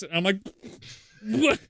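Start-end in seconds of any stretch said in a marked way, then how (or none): 1.2-1.6 raised, mildly incredulous retold "what"; baffled. Stunned teammate reacting to Greg's sudden agreement, baffled. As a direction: react in mock disbelief, pitch jumping up.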